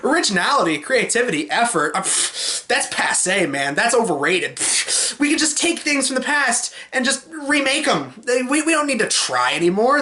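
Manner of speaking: mock exec with dismissive pffs; hammed-up "who cares" producer voice; scoffing studio executive. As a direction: pitch jumping up, waving effort away. Studio heads scoff at originality.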